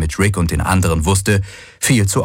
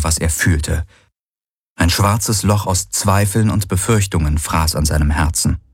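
plain reading voice

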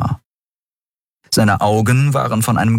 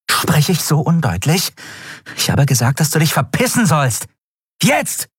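high, fierce demand; angry order; 9 semitones higher than usual. A narrator's reading. Andriel grabs the dealer by the collar, furious. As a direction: build to a high, sharp final command.

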